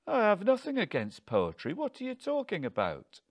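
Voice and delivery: high-pitched, defensive bewilderment; baffled husband defending himself; baffled protest. A narrator's reading. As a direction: protest in disbelief, voice climbing high.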